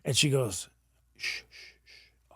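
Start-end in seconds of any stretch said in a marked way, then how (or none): 1.2-2.3 gentle, hushed "shh", repeated; soft shushing. Retold or imitated. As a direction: soothe with fading shushes. Retold woman shushing him gently.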